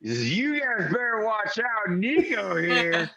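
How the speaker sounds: high, slow, slurred bravado; tipsy local defending his new friend; drunk warning. Acted. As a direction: wag a finger like a drunk. Drunk Randy warns table.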